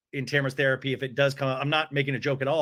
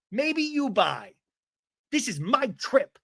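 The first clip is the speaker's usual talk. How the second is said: higher, slow, haughty mock line; mocking imitation; 9 semitones higher, slower than usual. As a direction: sneer it out like a put-down. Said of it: "she goes".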